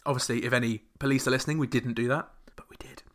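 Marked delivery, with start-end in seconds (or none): 2.6-3.0 very soft conspiratorial whisper. Pretend. Host confesses to pirating an album, under the breath as a whisper. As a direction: breathe it like a secret. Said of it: "confessing to piracy".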